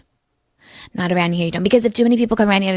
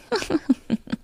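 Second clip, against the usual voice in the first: light laugh; 9 semitones higher, 8 dB quieter than usual. Real, not acted. Reacting to own joke.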